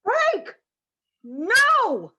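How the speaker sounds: sharp high shout. Acted. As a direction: yell it in protest. Wife objects to the camper trip.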